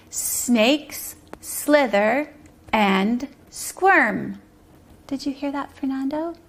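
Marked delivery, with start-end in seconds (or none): 0.1-4.4 very slow, one word at a time; slow message reading. Shown as a demonstration. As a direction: say each word separately, with clear pauses. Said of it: "Our first morning message is".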